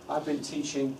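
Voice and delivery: usual speaking voice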